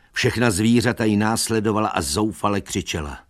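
usual speaking voice

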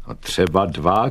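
a restrained shout of agreement; mildly called out. Acted. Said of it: "křičel hajný a rukou zval kluky".

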